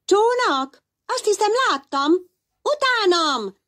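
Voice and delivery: nagyon magas, vidám, pörgős hang; lelkes kis kék hal. Acted. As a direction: lelkesen kiálts, mintha mindent tudnál. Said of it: "kiáltotta lelkesen egy kis kék hal". (Szenilla lelkesen hívja Pizsit maga után)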